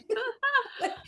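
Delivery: high, bursting laugh of disbelief; delighted laugh. Real, not acted. Guest laughing at her own cover news, delighted high laugh. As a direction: laugh out in happy shock. Gleeful reaction to magazine news.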